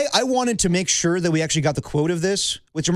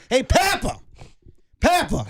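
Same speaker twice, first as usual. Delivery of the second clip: mispronouncing coach; 8 semitones higher than usual. Retold or imitated. High school coach mispronouncing a player's name, barked high. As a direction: coach barking the name, pitched way up. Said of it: "So instead of Papa, it was".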